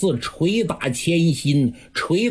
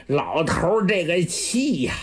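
ordinary delivery, nothing marked

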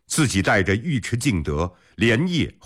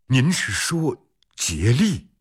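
higher-pitched, startled question; sudden alarm; 6 semitones higher than usual. A narrator's reading. General suddenly grasping who the true enemy is, alarmed. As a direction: realise it with a jolt, pitch jumping. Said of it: "脸色突然大变".

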